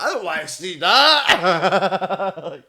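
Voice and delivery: high, loud mock-horrified cry; appalled teacher imitation. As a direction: yell it like a teacher shown something disgusting.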